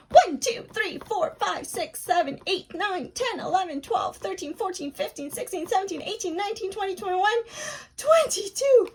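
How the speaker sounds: a race to the end of the count; super fast counting. Shown as a demonstration. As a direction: rattle the numbers off as fast as possible. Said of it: "Let's do it super, super fast".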